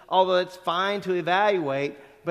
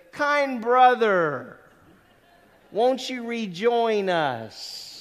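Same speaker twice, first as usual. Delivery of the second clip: very slow, higher, drawn-out gentle rebuke; a slow, courteous professor's summons; gentle nudge; 5 semitones higher, 6 dB louder, much slower than usual. Retold or imitated. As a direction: coax a daydreaming student back kindly. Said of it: "put his hand on your shoulder and say".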